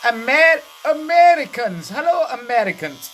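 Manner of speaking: high, loud excited salesman voice; hammed-up eager salesman greeting Americans; car rental guy. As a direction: shout a delighted greeting at the tourists. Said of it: "And he said".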